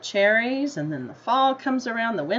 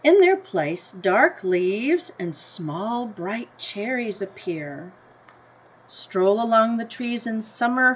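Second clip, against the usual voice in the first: story reading; 4 dB louder than usual. A narrator's reading. Teacher reading the class a picture book, in an unhurried storybook voice. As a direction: read it like a picture book to kids.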